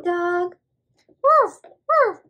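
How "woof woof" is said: high, loud playful puppy woofs; squeaky loud pretend barking; dog bark. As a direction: bark like an excited little dog.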